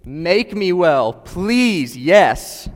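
loud, slow, high pleading cry of joy; mock eager shout, yes Lord please; eager scream. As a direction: beg at the top of your voice, drawn out. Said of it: "light up and scream".

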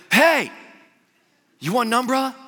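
high, loud call through the window. Retold or imitated. Pastor calling to a stranger in the rain, loud and high. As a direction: shout cheerily over the rain.

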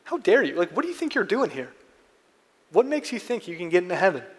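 high, indignant mock outrage; the crowd rounds on the criminal in hammed-up indignation; incredulous. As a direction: scold him as an upstart who doesn't belong. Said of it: "absolutely incredulous".

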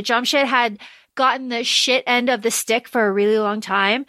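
ordinary talk

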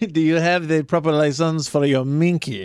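a Southern man's hammed-up French Inspector Clouseau accent; mock French accent. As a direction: play a bumbling French inspector, badly faked. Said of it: "Rednecks doing French accents".

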